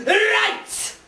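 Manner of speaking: loud high bellow; angry giant bellowing at his son; giant father shouting. Acted. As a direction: boom it out at the child. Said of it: "And then he said".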